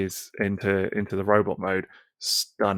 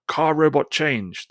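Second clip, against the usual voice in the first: higher, slow toy-robot announcement; hammed-up robot call; 5 semitones higher, slower than usual. Retelling the comic's speech bubble as a slow robot call. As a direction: call it out like a toy sound chip. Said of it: "the speech bubble or whatever says".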